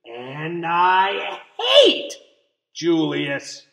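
very slow, loud, spat out; hateful declaration. A narrator's reading. Lily declaring her hatred of baby Julius, shouting. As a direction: drag out the hate, shouting. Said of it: "said Lily".

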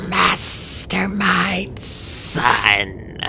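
very slow, whispery dragon voice; eerie, creaking creature whisper; rasp. A narrator's reading. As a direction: drag each syllable out in a breathy rasp. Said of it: "she rasped".

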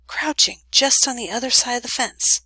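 usual speaking voice

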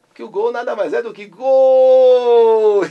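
slow, loud, high stretched shout; goal call. Acted. Radio host shouting a goal call, slow and loud. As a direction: bellow it like a football goal call.

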